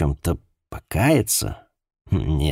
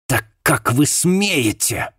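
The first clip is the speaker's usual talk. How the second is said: slow, spat-out outrage, higher; 8 semitones higher, slower than usual. Acted. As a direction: spit the words out in outrage. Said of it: "выплюнула она".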